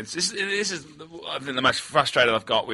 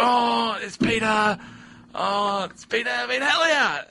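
put-on high-pitched gushing girl; put-on high, drawn-out delight; imitated starstruck recognition; 9 semitones higher, slower than usual. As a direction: mimic a fan's delighted discovery. Girl recognising him in retold scene.

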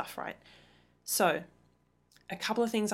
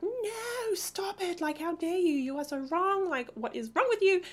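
much higher, shrill-sounding mock outrage at partner; indignant outburst; 11 semitones higher than usual. Parent mocking own outrage at partner, high indignant voice. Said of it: "you're like".

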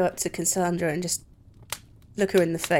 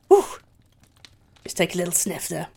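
usual speaking voice

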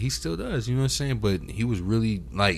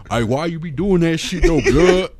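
mocking a West Coast rapper, higher and louder; 7 semitones higher, 7 dB louder than usual. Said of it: "I be mocking him sometimes".

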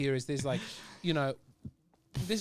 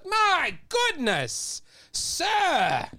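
prim shocked gentleman; 14 semitones higher, much slower than usual. Retold or imitated. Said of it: "And you go".

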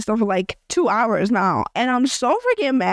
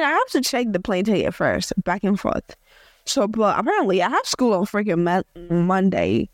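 ordinary talk

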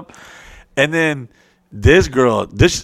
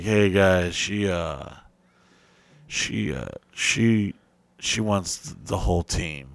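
lower, softer, halting; sheepish hesitation; 6 semitones lower, 8 dB quieter, slower than usual. Retold or imitated. Dave telling the guys she wants the whole team, low and halting. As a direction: stammer it out sheepishly.

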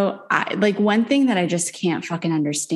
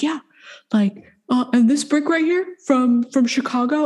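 lightly voiced slick developer, pitched higher; mock salesman voice; 7 semitones higher than usual. Mocking the developer touting Chicago brick, in a raised, put-on voice. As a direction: show off the brick like a proud salesman. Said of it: "he was like".

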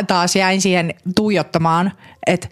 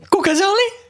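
surprised gasp; 12 semitones higher than usual. Real, not acted. Gasping to hear who it was.

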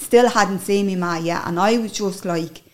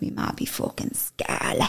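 whisper; 6 dB quieter than usual. Real, not acted. Recalling her late mother.

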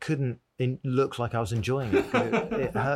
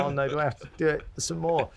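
higher-pitched, hammed-up dread; mock reluctance; 6 semitones higher than usual. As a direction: plead miserably, as a joke. Acting out miserable eating at audition.

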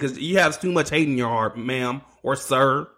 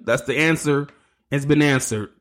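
usual speaking voice

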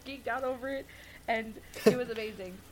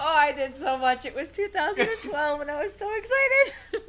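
excited; 6 semitones higher, 6 dB louder than usual. Real, not acted. Reliving meeting a favorite actress.

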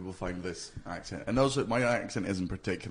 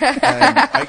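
high, loud laughing squeal into the words; 14 dB louder than usual. Real, not acted. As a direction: laugh through it, much higher and louder. Laughing while sympathising about tendinitis.

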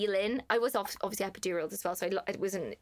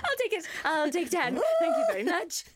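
very high, loud eager grab; eager greedy; 11 semitones higher, 3 dB louder than usual. Retold or imitated. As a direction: grab it like a treat. Eager for painkillers.

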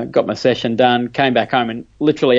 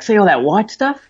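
excited doctor; 10 semitones higher than usual. Retold or imitated. Retold doctor showing scans.

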